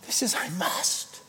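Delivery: whispered "I must" after the big build; breathless whisper of resolve. Real, not acted. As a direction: drop to a hushed whisper for the last word. Hushed climax of refrain.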